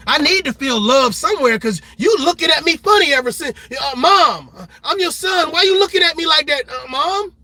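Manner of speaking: loud, high pleading son's voice; a hurt son crying out to his mom. Acted. Acted plea of a son.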